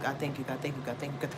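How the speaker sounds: soft murmured thanks; quiet prayer. Retold or imitated. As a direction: whisper thanks to God. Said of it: "like, a hundred times".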